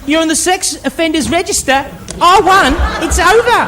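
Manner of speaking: high, triumphant uptalk taunt; gloating retort. Acted. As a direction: rub in the victory, pitch jumping up at phrase ends. Gloating at the man she accused.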